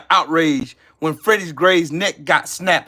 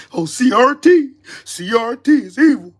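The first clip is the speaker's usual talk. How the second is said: higher, louder sneering parody; mocking CRT opponents; 7 semitones higher, 5 dB louder than usual. Mocking people who call CRT evil, sneering parody. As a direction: mimic them with scorn.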